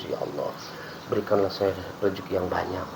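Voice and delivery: soft pleading prayer to God, voice dropped low; hushed mock prayer. Ustaz mimics a believer praying for wealth, softly pleading. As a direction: murmur a pretend prayer.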